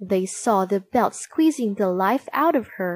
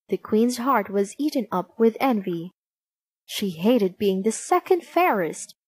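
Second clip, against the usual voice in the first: plain reading voice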